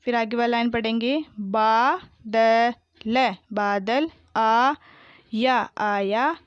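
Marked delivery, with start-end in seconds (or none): 1.4-3.3 बादल drawn out as baa, da, la; very slow बादल, each letter held apart; spelled out. Shown as a demonstration. Teacher sounding out बादल for pupils, syllable by syllable. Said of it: "मैंने इस तरीके से पढ़ा". 4.4-5.7 आया split into long aa and yaa; very slow आया, syllables held apart; spelled out. Shown as a demonstration. Teacher sounding out आया for pupils, syllable by syllable. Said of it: "मैंने इस तरीके से पढ़ा".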